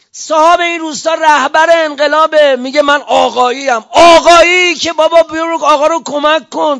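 loud, high, indignant oratory; impassioned shout. Real, not acted. Preacher rebuking self-declared followers of the leader, impassioned shout. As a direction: hammer it out at full force, stretching آقایی. Indignant appeal on the village's behalf.

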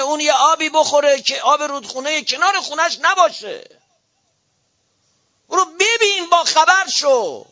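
high, loud pulpit harangue; fiery preaching. Real, not acted. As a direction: thunder at the clerics from the pulpit. Preaches at full force.

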